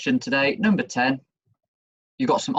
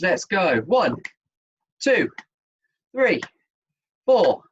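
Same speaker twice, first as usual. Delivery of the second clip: aerobics instructor; 5 semitones higher than usual. Acted. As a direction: hype the class through the reps. Energetic exercise counting.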